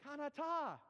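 high, drawn-out Thai-style Kanata; Canada as Kanata with Thai inflection; loud, high Thai-accented answer; picked-up Thai accent. Retold or imitated. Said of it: "the way they did".

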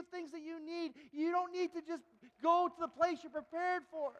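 loud, high, impassioned sermon climb; fervent pulpit preaching; full-force preaching. Acted. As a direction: drive it home to the congregation, slowing on key lines. Preaches to the congregation.

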